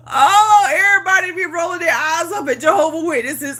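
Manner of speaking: loud, high laughing outburst, fast. Real, not acted. Mocking Jehovah Witnesses to viewers, loud laughing outburst. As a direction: burst out laughing at the idea.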